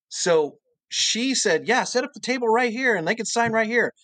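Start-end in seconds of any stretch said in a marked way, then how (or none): 1.7-3.9 high-pitched, eager go-ahead; imitated eager welcome. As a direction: imitate someone eagerly making room. Cartoonist eagerly agreeing.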